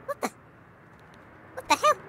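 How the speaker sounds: high, startled what the hell; sharp rising surprise; surprised. Real, not acted. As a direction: caught off guard by something weird. Sudden sight in game.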